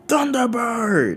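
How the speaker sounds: high, slow, drawn-out battle scream; screaming attack name. Acted. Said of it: "As she screams out".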